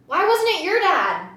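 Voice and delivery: retold frustrated question, high and loud. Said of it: "I'm really sad and frustrated".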